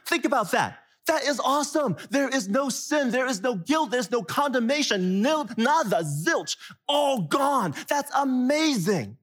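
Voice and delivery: excited. Real, not acted. Preacher exults over forgiveness.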